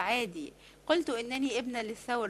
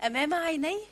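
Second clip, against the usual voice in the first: سؤال مذهول; 5 semitones higher than usual. Acted. سؤال ثم جواب بنفس الكلمات.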